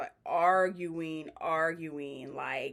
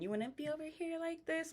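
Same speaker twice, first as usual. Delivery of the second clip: high, soft mock little-girl voice; 10 semitones higher, 7 dB quieter than usual. Mocking Yummy's talk off the confessionals, in a high little-girl voice. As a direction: put on a tiny girlish voice, higher and quieter. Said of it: "talking like a little girl".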